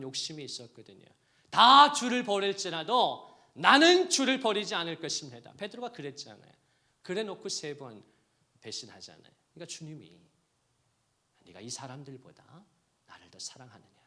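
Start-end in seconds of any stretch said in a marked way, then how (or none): 1.5-5.5 loud, high proud declaration; boastful vow. Acted. Peter's boastful vow quoted. 11.5-14.0 quiet question. Acted. Jesus asks Peter quietly.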